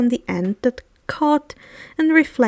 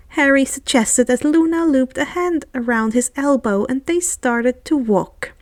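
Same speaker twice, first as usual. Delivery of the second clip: plain reading voice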